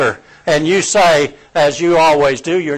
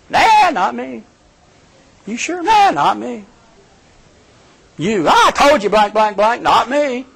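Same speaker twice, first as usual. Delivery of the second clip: higher, shrugging denials; denying Peter; 7 semitones higher than usual. Retold or imitated. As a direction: wave off the question. Peter denies, maiden questions.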